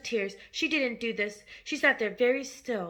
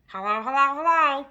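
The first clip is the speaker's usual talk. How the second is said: a louder squawking parrot; 3 semitones higher, 7 dB louder than usual. A narrator's reading. The parrot calls again to the frightened family, squawking. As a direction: bird calling from another room. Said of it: "came the voice again".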